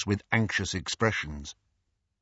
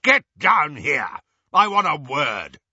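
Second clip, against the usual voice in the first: loud, raised angry order; angry uncle; 5 semitones higher, 5 dB louder than usual. A narrator's reading. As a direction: demand he comes down now. Vernon roaring at Harry.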